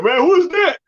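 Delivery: confused bystander. Retold or imitated. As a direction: play a baffled onlooker.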